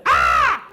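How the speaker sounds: loud, high shriek; child's scream. Retold or imitated. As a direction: cut in with an imitated kid's screech. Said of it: "Why are we screaming?".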